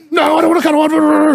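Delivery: high, shouted mock refusal of a cuddle. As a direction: yell it back at him in a put-on voice. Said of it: "she was all like".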